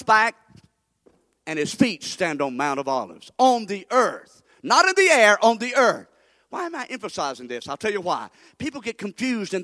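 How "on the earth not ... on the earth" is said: loud, high, slow contrast; thundering preaching. Real, not acted. Preacher driving home his point, loud. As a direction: slam on the earth hard.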